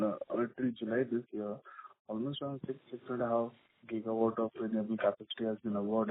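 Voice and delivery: very low, hard-to-hear voice; barely audible. Real, not acted. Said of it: "Your voice is very low".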